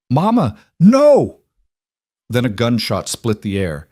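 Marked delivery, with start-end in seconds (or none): 0.1-1.4 put-on high, loud, drawn-out child scream; terrified child's cry. A narrator's reading. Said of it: "heard her daughter cry out".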